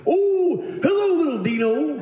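very high, gleeful Santa greeting; hammed-up jolly Santa hello; Brooks. Santa greeting an adult visitor, high jolly voice. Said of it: "what he would do when adults came in".

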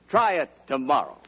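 ordinary delivery

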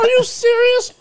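very high, loud disbelief; hammed-up incredulous outburst; mock astonishment. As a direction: squeal the question as if you can't believe it. Acts out astonished reaction to threesome.

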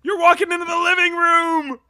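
hyped-up announcer voice, high and loud; energy drink ad. Acted. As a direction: pump it up like a hype man. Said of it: "That, wow".

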